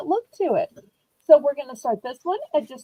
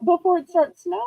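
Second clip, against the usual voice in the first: ordinary delivery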